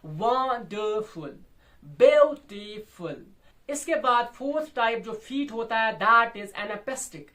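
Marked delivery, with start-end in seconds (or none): 0.0-1.4 wonderful drawn out, weight on वं; wonderful slowly, easing off after वं; strong-weak-weak stress. Shown as a demonstration. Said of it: "वो strong होता है". 1.8-3.2 beautiful slowly, loud punched view; beautiful in three beats, first strongest; strong-weak-weak stress. Shown as a demonstration. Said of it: "वो strong होता है".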